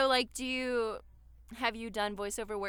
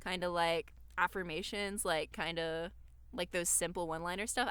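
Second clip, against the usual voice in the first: ordinary talk, nothing marked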